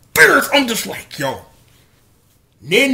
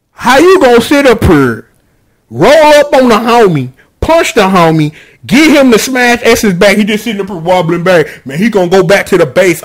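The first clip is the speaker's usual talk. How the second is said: louder, worked-up complaining; loud rant; 6 dB louder than usual. Real, not acted. Fan venting to listeners about the ending, louder. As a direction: call out the ending like it wronged you.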